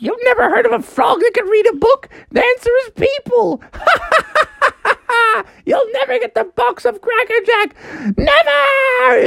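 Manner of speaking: high-pitched mocking villain rant; gloating talking house. House denies Froggy the prize, high mocking cackle. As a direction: taunt them high, cackle, end on a cry. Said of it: "And he's like".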